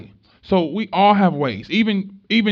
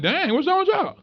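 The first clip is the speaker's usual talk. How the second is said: mock surprise; 13 semitones higher than usual. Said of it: "They like".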